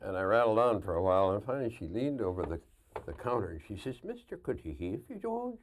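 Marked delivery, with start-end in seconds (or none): 4.0-5.6 high, put-on Irish woman's brogue; Irish lass accent. Irish lass at hotel counter rebuking a guest, Irish accent. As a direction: imitate an Irish receptionist's brogue, high and pointed. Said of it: "the prettiest Irish lass".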